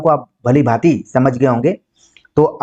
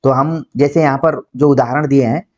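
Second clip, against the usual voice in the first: normal talking voice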